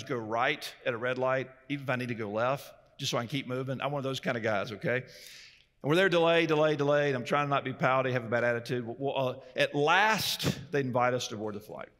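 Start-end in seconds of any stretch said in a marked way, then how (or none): 5.8-7.1 louder, fed-up repeating of "delay"; mock exasperation. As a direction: hammer out each "delay" impatiently.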